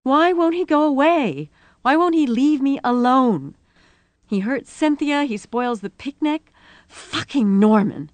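high, loud, anguished outburst; tearful rage. A narrator's reading. Rosie railing at Norman in the police car, enraged. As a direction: wail it in furious frustration. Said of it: "she was weeping with rage".

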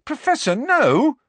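high, loud outraged cry; teen protesting an unfair punishment; furious protest. A narrator's reading. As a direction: burst out against the unfairness. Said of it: "furious at this injustice".

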